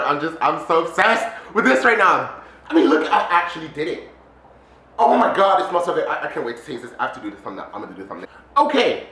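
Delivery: normal talking voice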